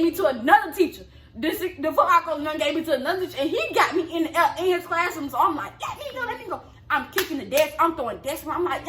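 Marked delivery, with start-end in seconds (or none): 5.9-6.6 high, softer mock cry to be released; retold struggling plea. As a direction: imitate a struggle to break free, pitched way up. Re-enacts struggling in teacher's grip.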